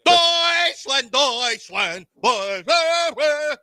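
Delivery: high, loud hammed-up German bellow; mock German shouting. Showing off his only German word, mock German shout. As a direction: shout it out, slurring into mumbles.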